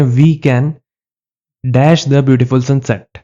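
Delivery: very slow, word by word dictation; slow reading. Shown as a demonstration. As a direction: read the blank sentence slowly. Reading quiz sentence to class. (धीरे-धीरे पढ़ना)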